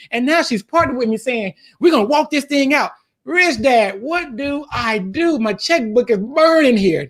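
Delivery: high-pitched, worked-up pleading; excited retelling. Retold or imitated. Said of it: "I never seen you so excited before".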